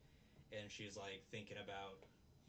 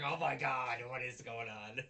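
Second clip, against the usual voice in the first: retold shock; 9 dB louder than usual. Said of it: "and I was just like".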